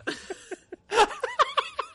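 shrieking laugh; high squeal of laughter. Real, not acted. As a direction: burst into high-pitched laughter. Laughing at joke.

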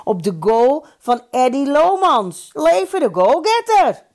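proposing a toast, ending in a high shout; rousing toast. A narrator's reading. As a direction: build from speech into a loud toast. Speech rising into a toast.

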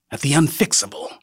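plain reading voice

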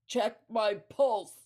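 slow, loud, strained effort to get the mouth working; reviving patient straining to speak to the paramedic, loud and slow; forced words. Retold or imitated. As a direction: push each word out with effort. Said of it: "I say with, like, words that he could hear".

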